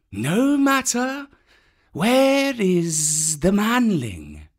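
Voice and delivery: high, slow snake voice; python Kaa. A narrator's reading. Kaa the python asking Baloo where the boy is. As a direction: drawl it slowly.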